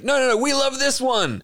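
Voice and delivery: high-pitched pleading of imagined listeners; mock fan protest.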